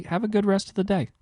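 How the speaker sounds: mock sweet girlfriend.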